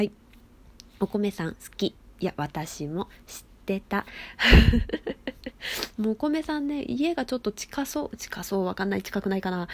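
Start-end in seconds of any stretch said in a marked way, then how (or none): 4.1-5.9 loud laugh. Real, not acted. Streamer bursting out laughing at a listener's message.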